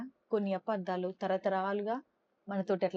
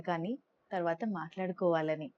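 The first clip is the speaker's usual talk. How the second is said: usual speaking voice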